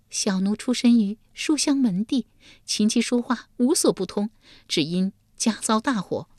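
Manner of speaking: Yulian's plaintive voice. Acted. Yulian confesses to Yama.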